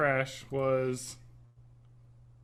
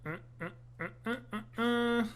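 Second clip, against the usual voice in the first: burping through the words; burping after fizzy drink; 5 semitones higher, 7 dB quieter than usual. Real, not acted. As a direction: talk between burps. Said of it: "I'm burping like crazy".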